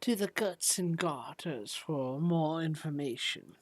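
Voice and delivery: put-on soft, musing old lady; Olive Stillwater. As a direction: recall it softly, taking your time as an old woman. Game master plays old shopkeeper.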